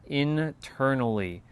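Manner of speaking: internally stretched slow, each syllable weighted; emphasize internally, slow and deliberate; stress on internally. Shown as a demonstration. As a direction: land hard on internally, slow. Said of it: "I'm going to emphasize this word".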